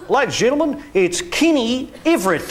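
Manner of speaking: high, loud showman intro; TV host announcing. Retold or imitated. Talk show host introducing Kenny to the audience, big showman voice. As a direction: announce him like a cheesy host. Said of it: "and he went".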